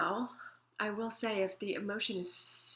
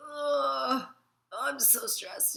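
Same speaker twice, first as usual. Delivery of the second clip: stressed groan; 4 semitones higher, 4 dB louder, much slower than usual. Shown as a demonstration. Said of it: "let yourself feel the stress".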